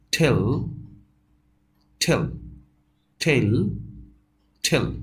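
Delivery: aspirated t in "ট্যালেন্ট" and "টেল"; spaced drill of "টেল" as "thel"; model pronunciation. Shown as a demonstration. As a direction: puff the t on each "টেল", pausing between. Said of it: "ট ঠ মিলে যেটা হয়".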